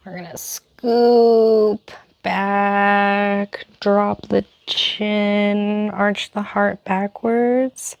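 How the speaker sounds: drawn-out words paced to slow movement; guided yoga instruction. Acted. Yoga teacher guiding seated cat cow, slow and stretched out. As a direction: talk them slowly through each move, stretching the key words.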